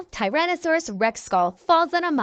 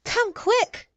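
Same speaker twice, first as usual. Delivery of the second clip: higher urgent call; shout; 9 semitones higher than usual. A narrator's reading. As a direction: hurry them over with a shout. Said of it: "Greg shouts".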